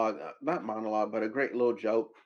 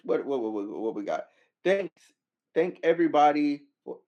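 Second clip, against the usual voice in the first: ordinary talk, nothing marked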